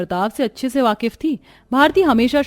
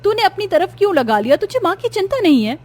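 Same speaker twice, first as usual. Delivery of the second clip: high, loud accusing calls; angry sister scolding the younger one; angry confronting; 7 semitones higher, 4 dB louder than usual. Acted. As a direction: shout at her sister for taking the cooler. Angry at sister about cooler.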